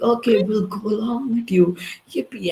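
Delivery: a high mock yippee; mock cheerful crowd. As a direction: chirp it like dim happy followers. Mocking crowd's meek agreement.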